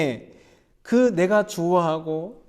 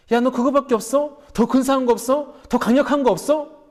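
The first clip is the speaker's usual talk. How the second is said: mock interrogation, pitched high and louder; God; 6 semitones higher, 5 dB louder than usual. God badgering Moses for something better, mock pressing. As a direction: fire off demanding questions as if pressing him. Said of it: "모세를 다그치지 않았습니다".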